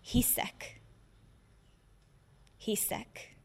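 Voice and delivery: near-whispered, soft and grave; hushed declaration. Acted. Reciter confessing belief after a proclamation, hushed. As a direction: drop to a hush, leaving space after each word.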